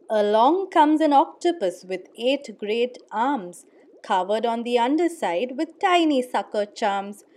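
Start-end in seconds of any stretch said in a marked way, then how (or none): none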